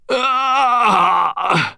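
high, loud pained gasp; pained cry. A narrator's reading. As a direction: cry out as the pain lands. Wounded man sagging in pain.

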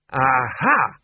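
loud exclamation; Arch-Chancellor trying another relic; triumphant aha. Acted. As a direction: brandish it proudly. Arch-Chancellor pulls another object.

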